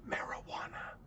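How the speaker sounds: smiling, secretive whisper; imitating a smiling conspirator under the breath; conspiratorial whisper. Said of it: "and in a smiling, conspiratorial voice says".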